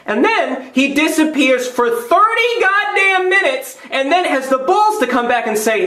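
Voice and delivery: high, drawn-out outrage; exasperated rant. Acted. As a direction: stretch it out in mounting disbelief. Exasperated rant about waiter disappearing.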